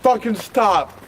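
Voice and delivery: ordinary talk, nothing marked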